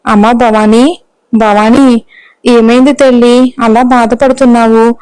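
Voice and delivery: ordinary delivery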